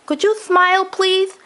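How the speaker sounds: put-on high old man's voice, grave and upset; a grandfather announcing a tragedy; distressed grandpa. Grandpa asks the officer.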